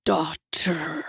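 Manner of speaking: low, quiet, possessive; low devil voice. A narrator's reading. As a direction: call her softly. Hasatan's voice.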